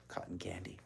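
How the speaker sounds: soft unvoiced whisper; whispered aside. Real, not acted. Reader explains spun sugar to children in a whisper. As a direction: let them in on a secret.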